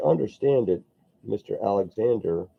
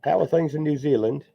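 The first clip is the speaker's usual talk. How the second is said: much higher, louder, vaguely foreign greeting voice; a clumsy stab at a Kiwi-ish accent; bad accent attempt; 8 semitones higher, 4 dB louder than usual. Painter greeting a New Zealand viewer in a bad put-on accent. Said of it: "I have no idea what kind of accent that was".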